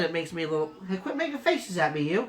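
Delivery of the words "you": normal talking voice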